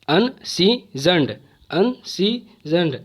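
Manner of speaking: "अनसीजन्ड" slowly as अन, सी, जन्ड; pauses between the three parts of "अनसीजन्ड"; syllable by syllable. Shown as a demonstration. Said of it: "इसमें तीन syllable है". ("अनसीजन्ड" को तीन टुकड़ों में रुक-रुक कर)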